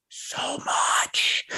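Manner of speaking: sarcastic whisper. Real, not acted. Mocking McDonald's concern.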